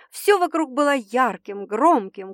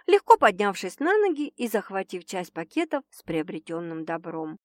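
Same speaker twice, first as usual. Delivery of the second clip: plain reading voice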